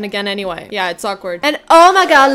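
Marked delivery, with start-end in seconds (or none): 1.4-2.3 louder, higher burst of recognition; loud delighted "oh my god"; excited. Real, not acted. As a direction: exclaim it as you spot someone. Spots Malcolm, excited.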